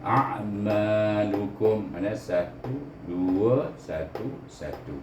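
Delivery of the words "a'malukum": A'malukum drawn out; very slow A'malukum; over enunciating. Shown as a demonstration. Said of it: "kum pun satu, izhar syafawi".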